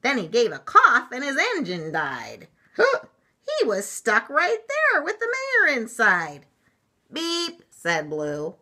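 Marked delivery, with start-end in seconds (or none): 0.0-6.5 high, loud, dramatic rhyme with a gasp; story read-aloud. A narrator's reading. Reads the picture book aloud. 7.1-7.6 one short high beep; truck horn beep. Acted. Blue's horn in story.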